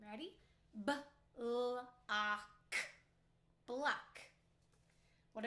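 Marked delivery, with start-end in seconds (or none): 0.9-1.9 g-r-ate said sound by sound, slowly, each sound apart; chopped into sounds. Shown as a demonstration. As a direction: break g-r-ate into its separate sounds for the class. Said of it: "I'm going to chop it out loud".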